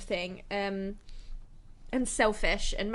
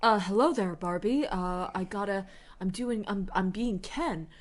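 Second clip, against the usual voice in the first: dopey Ken voice. Retold or imitated. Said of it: "play Ken like".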